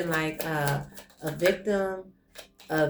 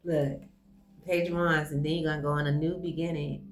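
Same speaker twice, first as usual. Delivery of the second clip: ordinary talk, nothing marked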